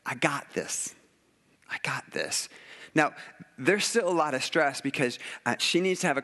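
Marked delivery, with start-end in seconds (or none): 1.7-2.5 hushed, soft and low, breathed out; whispered echo of God's reassurance. Retold or imitated. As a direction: breathe it like a calm secret promise. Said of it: "God's like".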